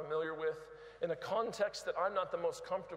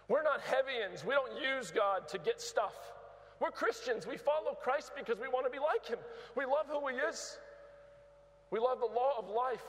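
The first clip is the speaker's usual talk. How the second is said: preaching at full force; 5 semitones higher than usual. Real, not acted. Preaching climax.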